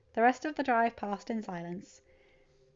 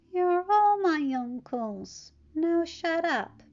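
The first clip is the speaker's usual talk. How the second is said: put-on higher, slow, drowsy teen voice; sleepy teen; 9 semitones higher, 5 dB louder, much slower than usual. Sleepy teen telling the bickering uncles off, drowsy. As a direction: mumble it half asleep but pitched up. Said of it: "Peter's sleepy voice".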